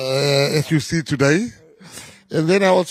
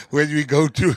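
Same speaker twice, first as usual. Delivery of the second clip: laughing; 5 dB louder than usual. Real, not acted. Laughing at own tattoo joke.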